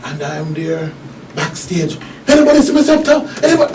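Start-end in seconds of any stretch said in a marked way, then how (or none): 2.3-3.7 high frantic calling in character; frantic search, voice pitched way up; panicked pharaoh voice. Retold or imitated. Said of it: "I'm speaking with a fear of voice".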